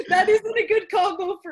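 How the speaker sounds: laughing through the words; giggling while talking. Real, not acted. Laughing at friend's story.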